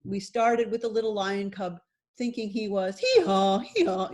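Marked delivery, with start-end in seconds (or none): 3.0-4.1 mock donkey hee-haw; donkey bray. Little lion thinking he was a donkey, playful bray. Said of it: "making donkey sounds".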